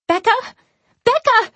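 high, loud panicked call. Acted. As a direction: yell her name in panic. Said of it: "she calls out".

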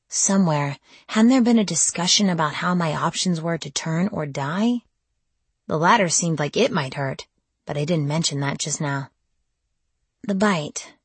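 ordinary delivery, nothing marked

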